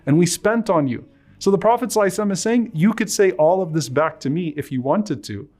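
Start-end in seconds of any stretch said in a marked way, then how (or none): 0.1-1.0 impassioned preaching. Acted. Preacher voicing the Prophet's reply to the Ansar, impassioned. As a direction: preach it with rising passion, pleading and proud.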